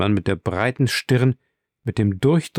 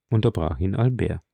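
ordinary delivery, nothing marked

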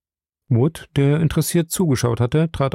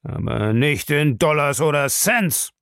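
put-on old man's angry retort, pitched up; angry flare-up; 4 semitones higher than usual. A narrator's reading. Bellamy retorts to Krieger, flaring up angrily. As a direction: flare up crossly at the threat. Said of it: "fuhr der Alte böse auf".

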